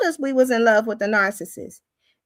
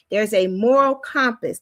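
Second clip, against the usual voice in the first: ordinary talk, nothing marked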